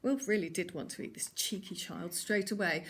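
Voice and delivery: usual speaking voice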